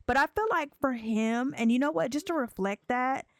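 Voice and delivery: ordinary delivery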